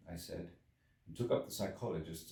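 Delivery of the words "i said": plain reading voice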